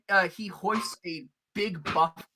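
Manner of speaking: normal talking voice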